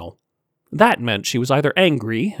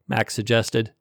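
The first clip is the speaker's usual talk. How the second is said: ordinary delivery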